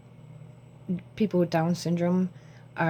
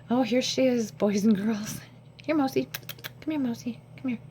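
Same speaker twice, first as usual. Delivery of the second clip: much higher, sing-song call to the cat; high pet-calling voice; 6 semitones higher than usual. Real, not acted. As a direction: coax the cat over in a high voice.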